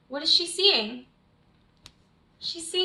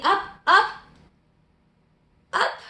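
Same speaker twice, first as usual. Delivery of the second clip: louder and higher, building up; rising excitement; 6 semitones higher, 7 dB louder than usual. A narrator's reading. Yellow grows huge.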